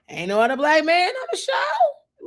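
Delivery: incredulous outcry. Real, not acted. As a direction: stretch it out and let the pitch soar. Repeats the complaint, escalating to a shout.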